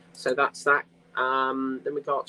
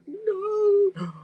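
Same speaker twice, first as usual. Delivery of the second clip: anguished wail. Retold or imitated. Teasing friend about cake pictures, mock anguished cry. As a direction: wail it like the cakes are torture. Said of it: "And I was like".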